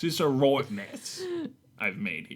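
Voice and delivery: put-on light British accent; lightly done English gentleman accent; Harker British accent. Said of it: "Was that your Transylvanian accent?".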